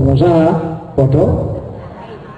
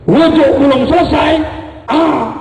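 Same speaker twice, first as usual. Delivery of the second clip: dramatic build-up; 10 semitones higher than usual. Retold or imitated. Suspense before tiger appears.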